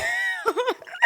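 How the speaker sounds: very high burst of laughter; shrieking laugh. Real, not acted. Storyteller recalling superfan's attack, shrieking laugh. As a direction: crack up with a high squeal. Cracks up telling the row.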